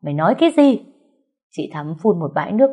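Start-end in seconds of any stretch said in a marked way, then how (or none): 0.0-0.8 sharp furious demand, raised and loud; furious challenge; angry shout. A narrator's reading. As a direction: yell it in her face. Furious confrontation in story.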